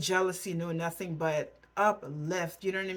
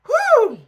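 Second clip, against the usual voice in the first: emotional exhale; 15 dB louder than usual. Real, not acted. Reactor shaking off tears.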